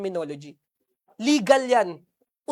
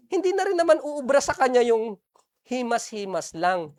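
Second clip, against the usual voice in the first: ordinary talk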